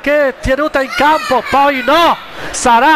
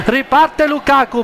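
ordinary delivery

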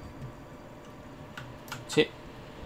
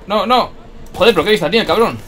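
loud, high annoyed curse; loud exasperated cursing; frustrated outburst; 7 semitones higher, 5 dB louder than usual. Real, not acted. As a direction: swear at the sharp-eyed enemy. Enemy spots him, frustrated.

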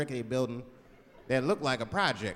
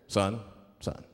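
much lower, measured cop voice; police officer; 10 semitones lower than usual. Acted. Policeman to a driver he pulled over, low stern officer. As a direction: drop low like an officer at the car window. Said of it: "the police pull me over".

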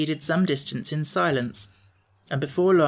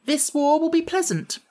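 bright, much higher and breezy; cheerfully; 12 semitones higher than usual. A narrator's reading. Girl general brushing off the boy's worry, cheerfully. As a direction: toss it off lightly, all good cheer. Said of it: "replied the girl cheerfully".

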